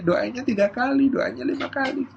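put-on high, soft little-kid request; pleading child. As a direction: bargain with a parent in a high child's voice. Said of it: "Akhirnya cuma minta". (anak merengek meminta, nada tinggi)

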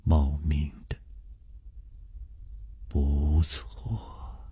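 low, soft mutter to himself. A narrator's reading. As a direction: murmur it, lost in thought. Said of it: "楚留香喃喃地说". (喃喃自语)

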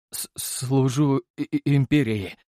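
slow, stuttering, frightened reply; nervous stammer. Acted. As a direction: force the words out in fear. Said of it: "выдавил из себя я и нервно сглотнул".

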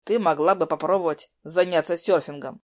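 plain reading voice

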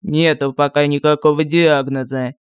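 grumpy low mutter; недовольно буркнул. A narrator's reading. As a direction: grumble it, annoyed. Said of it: "недовольно буркнул один из лекарей". (недовольное бурчание)

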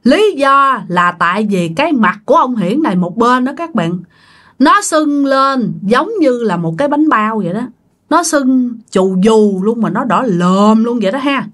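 ordinary delivery, nothing marked

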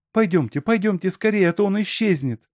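high-pitched, urgent repeated plea; insistent urging. A narrator's reading. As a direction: hurry them along, voice high. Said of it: "А Алёнка всё повторяла". (настойчиво торопит высоким голосом)

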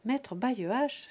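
usual speaking voice

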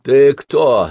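slurring, just woken up; slurred groggy voice. Acted. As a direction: drag the words like half asleep. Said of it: "заплетающимся голосом".

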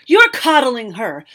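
loud, clipped wizard's fury; fuming. Acted. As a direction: snap it out, fed up with her. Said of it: "the wizard fumed".

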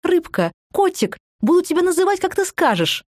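put-on high, cooing lisp-like baby talk; cutesy baby talk. A narrator's reading. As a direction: coo it like talking to a baby. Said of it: "тут же засюсюкал Сергей".

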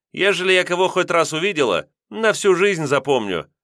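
высоко, с напором, певуче; заверить с гордостью; уверенно. A narrator's reading. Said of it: "заверила женщина".

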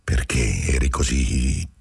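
low, slow, unsettled question; troubled. Acted. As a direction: ask it shaken, almost afraid of the answer. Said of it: "domandò Marcello turbato". (turbato, lento e grave)

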